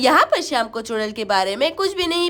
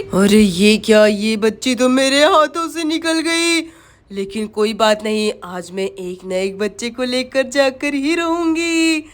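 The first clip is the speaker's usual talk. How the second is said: menacing witch; 6 semitones lower than usual. Acted. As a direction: stretch out the threat like a storybook witch. Witch lost her prey. (चुड़ैल की भारी, धमकाती आवाज़)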